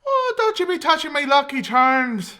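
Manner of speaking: a lightly done Irish brogue; leprechaun. Acted. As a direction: warn them off in an Irish lilt. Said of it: "Did I do that correctly?".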